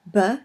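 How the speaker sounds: B said as a short "buh"; letter sound B. Shown as a demonstration. Teacher showing learners how Bet is pronounced, letter sound B. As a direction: voice B as its bare consonant sound. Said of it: "This is pronounced either B".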